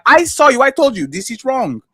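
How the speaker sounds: ordinary talk, nothing marked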